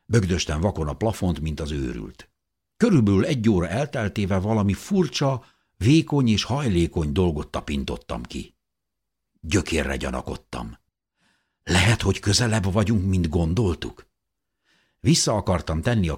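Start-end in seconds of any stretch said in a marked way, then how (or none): none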